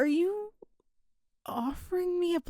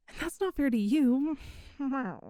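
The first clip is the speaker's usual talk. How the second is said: ordinary delivery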